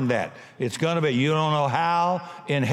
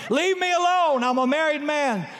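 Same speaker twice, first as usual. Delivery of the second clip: high, hammed-up drunk's yelp; mock scream; 10 semitones higher than usual. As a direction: yelp it like a scandalised drunk husband. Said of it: "you started screaming".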